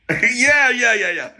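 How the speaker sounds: high, loud, gleeful yeahs; bubbling over with excitement; excited yeahs. Retold or imitated. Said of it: "and I'm getting excited".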